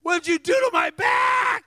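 high, loud, drawn-out mock scream; imitating a man screaming, very high and slow; retold scream. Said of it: "he starts screaming".

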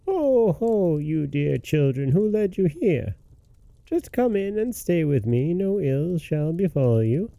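put-on high, coaxing old witch voice; old woman. A narrator's reading. Old witch inviting the children inside, high sweet-talking voice. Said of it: "the old woman shook her head and said".

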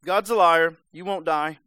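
Nope shouted high and loud, then pressed on; loud serpent retort. Retold or imitated. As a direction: snap back loud and high as the lying snake. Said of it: "And he says".

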